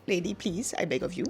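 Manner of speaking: half-whispered plea, a touch of retold crying; begging the nurse for the epidural, softly and tearfully; pleading through tears.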